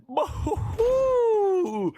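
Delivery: laughing high whoop of delight; excited whoop. Real, not acted. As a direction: cheer the play out loud. Caster cheers a big play.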